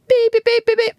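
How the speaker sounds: very high electronic-style beeps; imitating censor bleeps. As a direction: mimic a TV bleep in a high pitch. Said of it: "the beeping is actually".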